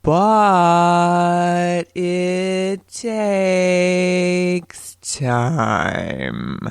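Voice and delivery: extremely slow, each word stretched and held; drawn out. Shown as a demonstration. Host shows that gain takes time, exaggeratedly drawn-out words. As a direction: drag every word out as if time is crawling. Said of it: "what I did there".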